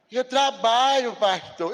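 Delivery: slow, mock-whiny excuse; lightly voiced reluctant member; excuse-making member. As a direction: drag it out like a lame excuse. Pastor mimics a member's excuse.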